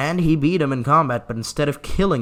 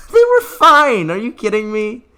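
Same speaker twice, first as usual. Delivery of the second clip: laughing disbelief; 9 semitones higher, 10 dB louder than usual. Real, not acted. Learns the lords survived.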